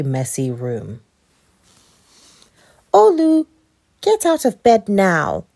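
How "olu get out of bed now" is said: put-on high, loud call to a child in bed; raised, high-pitched motherly command; scolding mom. A narrator's reading. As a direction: mom ordering her son up. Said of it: "said his mom one morning".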